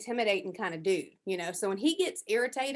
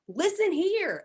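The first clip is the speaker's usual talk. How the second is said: feisty challenge; 10 semitones higher, 5 dB louder, much slower than usual. Retold or imitated. Confronting husband in argument.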